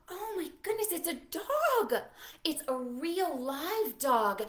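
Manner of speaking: high delighted exclamation; thrilled discovery; excited wonder. Acted. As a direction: marvel at the picture for the kids. Reacting to book picture.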